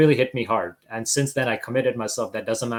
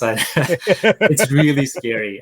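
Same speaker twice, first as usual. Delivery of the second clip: laugh, then high, loud, drawn-out words; real laughing admission; 4 semitones higher, 7 dB louder, much slower than usual. Real, not acted. Rappeller admitting the height is scary, laughing. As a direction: laugh through it, stretching the words out.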